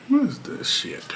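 breathy whispered "what is this shit?"; whispered aside. Real, not acted. Vlogger whispers a puzzled aside to herself.

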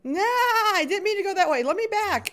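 high laughing plea. Real, not acted. Gamer laughing after walking the wrong way. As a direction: laugh through the mistake.

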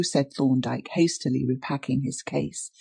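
ordinary delivery

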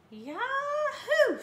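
high, loud cowboy whoop; exuberant shout. A narrator's reading. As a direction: holler it out, voice leaping high. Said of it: "shouted Bubba".

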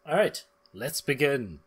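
ordinary talk, nothing marked

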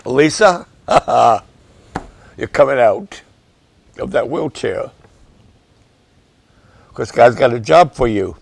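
loud, fervent call to Lisa; fired up. Real, not acted. Preacher to Lisa in a wheelchair, loud fervent declaration. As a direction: declare it over her with rising fervour. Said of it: "Let me calm down".

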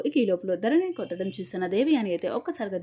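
ordinary delivery

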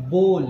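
बोल with a long o, no w sound; correct pronunciation. Shown as a demonstration. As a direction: say बोल as "bol". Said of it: "बोल पढ़ते हैं". (बोल, लंबा "ओ")

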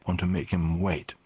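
ordinary delivery

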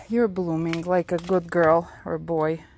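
usual speaking voice

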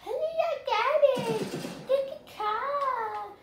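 high, loud, slow mocking voice; mock commenter voice. Streamer imitating viewers in the comments, mocking. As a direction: put on a silly voice to mock the viewers. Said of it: "I don't know what any of you guys sound like".

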